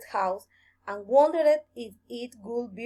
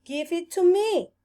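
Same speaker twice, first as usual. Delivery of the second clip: high, loud demand; 7 semitones higher, 4 dB louder than usual. A narrator's reading. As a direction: insist on it firmly. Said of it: "demanded the rabbit".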